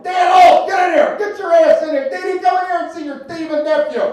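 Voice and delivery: high loud shout across the house; screaming uncle. Retold or imitated. Uncle hollering for his nephew over a lost calculator, loud high shout. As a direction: holler for the nephew to get in here. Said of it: "He's screaming and hollering".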